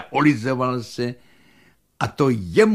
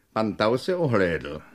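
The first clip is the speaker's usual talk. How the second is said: usual speaking voice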